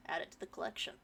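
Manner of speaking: breathy whispered aside. Real, not acted. Streamer noting another song cover for chat, whispered. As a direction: whisper it to yourself.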